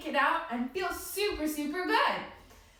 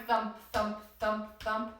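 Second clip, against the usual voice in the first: heartbeat thumps; 6 semitones lower, 4 dB quieter, slower than usual. Shown as a demonstration. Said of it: "Can you feel your heartbeat".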